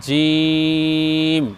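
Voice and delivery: জিম held very long and slow; drawn-out letter. Shown as a demonstration. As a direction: stretch জিম like a Quran teacher. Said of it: "আমার সঙ্গে বলতে থাকুন". (ধীরে টেনে জিম উচ্চারণ)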